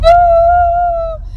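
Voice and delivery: high, drawn-out crying howl; mock hysterical wail. Mom retelling the girl crying after the dance, mock wailing. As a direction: imitate a girl's long hysterical wail. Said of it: "she was hysterically crying".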